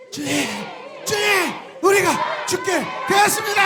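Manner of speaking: congregation-led panicked cry; desperate shout. Shown as a demonstration. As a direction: scream it like the boat is going down. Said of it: "성경 그대로 좀 하셔요".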